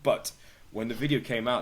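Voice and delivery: usual speaking voice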